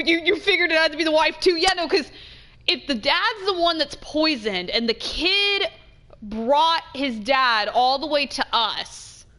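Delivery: ordinary talk, nothing marked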